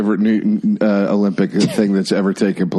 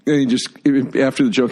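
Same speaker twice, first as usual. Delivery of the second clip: ordinary talk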